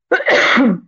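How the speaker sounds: second cough, still choking. Real, not acted. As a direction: clear the throat after choking. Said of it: "Perdão, engasguei aqui".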